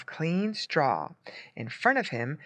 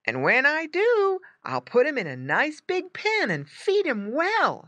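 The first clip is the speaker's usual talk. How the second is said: put-on high-pitched boy's voice; eager boy; 11 semitones higher than usual. A narrator's reading. Boy to the farmer about his pet, eager. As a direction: promise eagerly in a child's voice.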